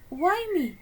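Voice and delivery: high, loud frightened yelp; Lemon, scared of the sack, yelps high; alarmed squeak. Acted. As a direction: protest in a high panic.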